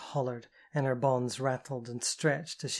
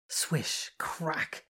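a hissed swish and a sharp crack; cane swish and crack; 3 semitones higher than usual. A narrator's reading. Narrator voices the cane strike, swish then crack. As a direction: make the cane's sound with your mouth.

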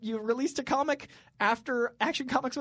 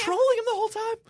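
excited; 6 dB louder than usual. Real, not acted. Excited retelling, laughing.

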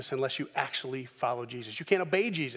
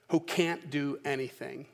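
normal talking voice

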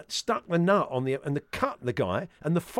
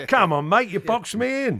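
louder, higher, slow Cockney lorry driver; Cockney accent; 6 semitones higher, 5 dB louder than usual. Pretend. Presenter playing a blocked-in driver at a caff, mock Cockney complaint. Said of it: "nice Cockney accent".

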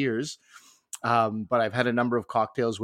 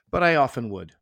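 normal talking voice

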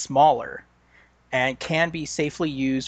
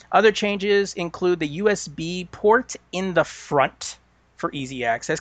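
normal talking voice

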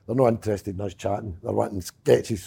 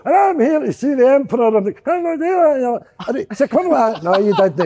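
laughing while acting out the panto back-and-forth; high and loud, laughing through the lines; laughing retelling; 11 semitones higher, 5 dB louder than usual. Telling an interviewer about the panto crowd, high and laughing.

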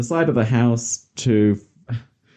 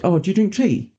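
mimicking people; 8 semitones higher than usual. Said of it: "they're like".

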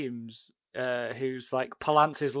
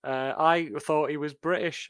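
ordinary delivery

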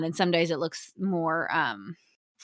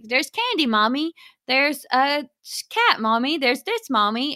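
put-on high-pitched little child calling Mommy; Lawson; 4 semitones higher than usual. Mother imitates her son naming things, high childlike voice. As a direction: imitate a toddler pointing things out. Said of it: "Lawson is in this phase".

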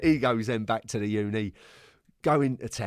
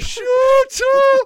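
shrill mock manager, much higher and louder; high squeaky gaffer voice; 13 dB louder than usual. Manager shouting at late player, high mock voice. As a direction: squeak it out like an angry little boss. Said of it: "He had this voice".

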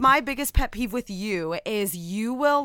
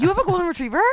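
higher, disbelieving question; mock surprise; 9 semitones higher, much faster than usual. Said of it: "sometimes I'll be like".

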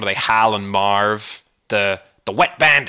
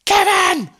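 high, loud shriek of the name; mother realizing her son was left behind, shrieking; panicked shout; 5 dB louder than usual. Retold or imitated. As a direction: scream it in sudden horror. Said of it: "realizing it's her son and going".

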